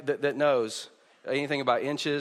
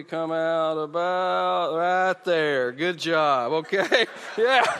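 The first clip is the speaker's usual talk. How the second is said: laughing; 3 dB louder, much slower than usual. Real, not acted. Laughing with kids on stage.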